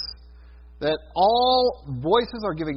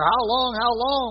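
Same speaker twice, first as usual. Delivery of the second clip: pleading martyrs; 6 semitones higher than usual. Retold or imitated. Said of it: "the martyrs under the altar".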